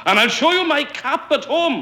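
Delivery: high, loud, outraged insistence; rising fury. A narrator's reading. Stranger answers Enoch Davis's doubt, loudly outraged. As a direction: insist at a furious high pitch. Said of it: "the stranger said in a fury".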